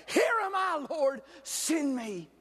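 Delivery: impassioned pulpit cry; fervent declaration. Acted. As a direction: call it out as an answer to God. Models the congregation's answer, first take.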